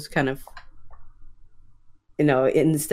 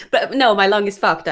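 ordinary talk

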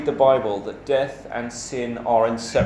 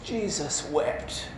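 drawn-out, quiet exclamation of disbelief; mock expletive; 8 dB quieter, slower than usual. Someone reacts to something crazy going on, slow mock expletive. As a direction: say it slowly as an emphatic oath. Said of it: "just as a bit of an expletive".

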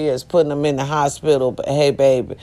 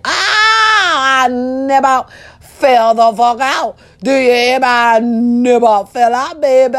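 overjoyed; 6 semitones higher than usual. Real, not acted. Overjoyed reaction to proposal.

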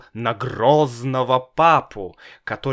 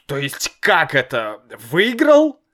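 stunned disbelief; 3 semitones higher, 5 dB louder than usual. A narrator's reading. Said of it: "тихо спросил папа".

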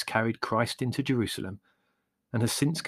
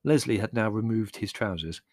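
plain reading voice

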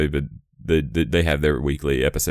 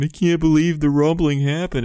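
mock crying; 11 semitones higher, 3 dB louder than usual. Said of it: "people are crying".

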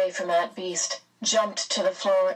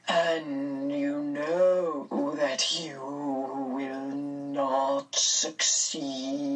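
otherworldly being; 3 semitones lower, much slower than usual. A narrator's reading. Mrs Which warning Mr Murray, very slow and eerie. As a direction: draw every vowel out eerily, pitched slightly down.

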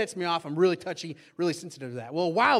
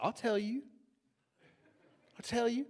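ominous wind-up; 5 semitones higher, 5 dB quieter than usual. Retold or imitated. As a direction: hold back before the accusation, softly. Sulker winding up for his grievance.

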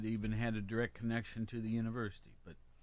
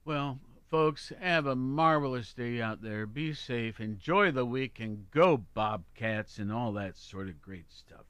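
hearty sign-off; 8 dB louder than usual. Real, not acted. Host signs off to listeners.

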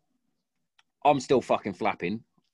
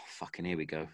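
muttered under breath; 6 semitones lower, 12 dB quieter than usual. Retold or imitated. Muttered quote at approaching moped.

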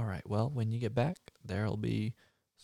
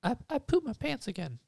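put-on high little kid voice; little boy voice; 10 semitones higher than usual. Little Billy confessing to his dad in a high childish voice. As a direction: squeak it out like a guilty small boy.

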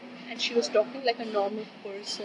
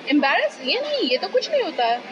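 put-on fast, higher, louder childish denial; mimicking a child; 5 semitones higher, 5 dB louder, much faster than usual. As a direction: brush it off like a stubborn kid.

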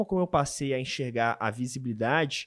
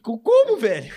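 a sudden, much higher and louder cry of disbelief; incredulous; 6 semitones higher, 9 dB louder, slower than usual. Real, not acted. Joking with friends about the order, an incredulous squeal. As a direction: squeal the word in mock disbelief.

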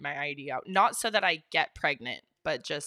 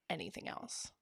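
whispered; 13 dB quieter than usual. Real, not acted. Trails into a whisper.

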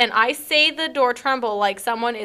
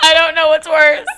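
laughing through the words, a bit louder; 5 semitones higher, 9 dB louder than usual. Real, not acted. To her cohost about the joke, laughing. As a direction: giggle it out.

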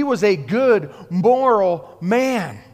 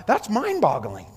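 ordinary talk

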